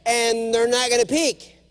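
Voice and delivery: lightly Scottish swagger; mock Scottish accent. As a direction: brush off the wife with Scottish bravado. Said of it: "I'm acting like the Scotsman".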